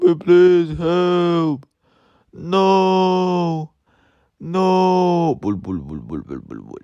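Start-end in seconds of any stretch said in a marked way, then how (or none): 5.4-6.8 deep burbling bull bull noise; low burbling noise. Pretend. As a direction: rumble it out low like a toy engine. Playing, toy noise effect.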